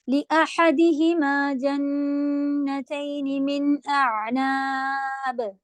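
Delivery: slow Quranic recitation. Shown as a demonstration. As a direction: recite the verse slowly. Models the verse recitation. (آہستہ تلاوت)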